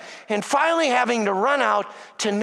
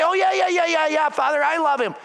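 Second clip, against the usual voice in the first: mock offhand reply; 5 semitones higher, 3 dB louder, faster than usual. Mocking casual believer.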